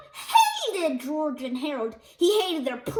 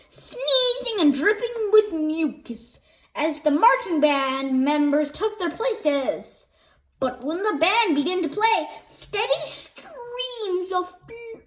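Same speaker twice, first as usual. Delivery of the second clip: usual speaking voice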